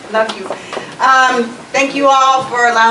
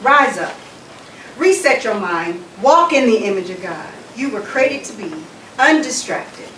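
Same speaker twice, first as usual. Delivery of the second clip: lower-pitched, measured closing address; formal exhortation; 5 semitones lower, 3 dB quieter than usual. Real, not acted. Speaker sends the congregation off in peace, formal exhortation. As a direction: deliver a solemn blessing in even, rolling phrases.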